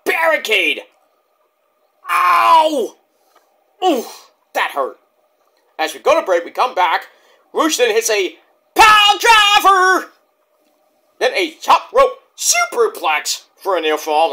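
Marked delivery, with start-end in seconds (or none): 2.1-3.0 pained yelp. Retold or imitated. Wincing at barricade spot. 7.5-12.2 high, loud, slowed hype call; excited call. Real, not acted. Fan calling a pile driver, hyped.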